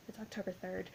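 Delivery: very soft, understated reply; imitated Katie. Imitating Katie answering Aaron Samuels about the day, soft and understated. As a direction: answer quietly, barely projecting. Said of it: "and she's like".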